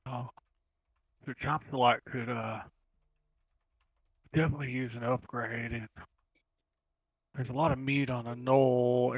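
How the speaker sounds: soft, halting in-character musing; quiet musing. Acted. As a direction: ponder it softly to yourself, with long pauses. Character musing to himself while listening.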